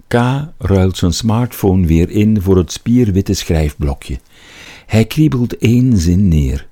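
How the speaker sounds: usual speaking voice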